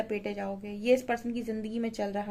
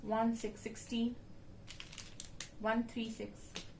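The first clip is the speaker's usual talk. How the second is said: quiet read-out; 12 dB quieter, slower than usual. Real, not acted. Reading out drawn letters quietly.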